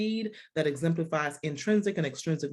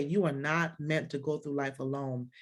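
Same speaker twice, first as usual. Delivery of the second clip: ordinary talk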